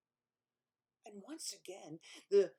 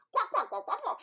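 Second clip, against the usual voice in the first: high, loud quacks; mock quacking; 12 semitones higher, 9 dB louder than usual. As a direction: farmyard squawk. Imitating chickens.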